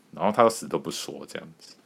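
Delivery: ordinary talk, nothing marked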